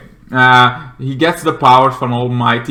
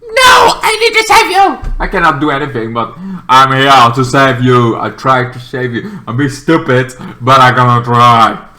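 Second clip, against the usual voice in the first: desperate hero; 5 dB louder than usual. Retold or imitated. Green Boy insisting to the hero he'll save him, crying out. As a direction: plead that you'll save him anyway. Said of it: "He's like".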